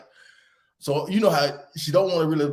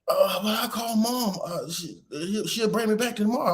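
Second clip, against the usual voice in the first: high, hesitant kid imitation; flustered daughter; 9 semitones higher than usual. Daughter suggests calling mom to fix it, hesitant imitation. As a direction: imitate a child feeling out an excuse. Daughter pleading her case, voiced by dad.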